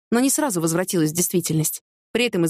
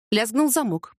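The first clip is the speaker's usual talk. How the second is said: usual speaking voice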